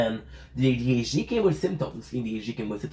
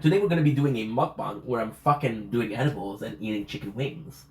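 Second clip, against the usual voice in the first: ordinary talk, nothing marked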